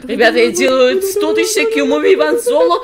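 ordinary talk

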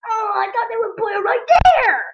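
high, loud complaint rising to a shout; exasperated outcry. Real, not acted. Gamer caught by a trap, exasperated outcry. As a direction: cry out at being tricked.

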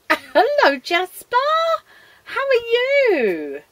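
high, loud, drawn-out hello; excited greeting. Real, not acted. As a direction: gush at a beloved pet who just turned up. Gushing greeting to visiting pet.

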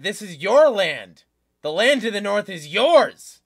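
loud, emphatic proclamation; acted rallying cry. Retelling an official urging fighters on, loud proclamation. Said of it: "pointing at him and saying".

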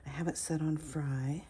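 ordinary delivery

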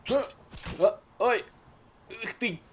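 high strained grunting; straining grunts. Acted. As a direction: groan as if stuck. Soldier stuck, struggling.

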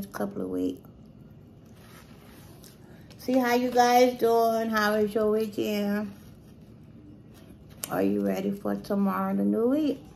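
talking between bites; chewing while talking. Real, not acted. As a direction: mouth partly full. Seen 1: Fork bite at -3s; food raised to mouth around 1.6s.

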